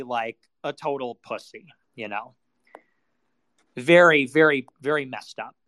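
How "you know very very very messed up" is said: ordinary talk, nothing marked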